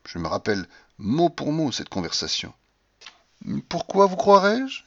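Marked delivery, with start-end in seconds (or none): 3.7-4.8 slow, high-pitched haughty question; contemptuous. A narrator's reading. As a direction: draw it out with scorn and vanity. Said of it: "tant de mépris, de ruse et de vanité".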